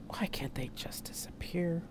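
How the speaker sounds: slow, hushed whisper; whispered thought. A narrator's reading. Narrator's private thought as danger strikes, whispered. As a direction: whisper it slowly, as if to yourself.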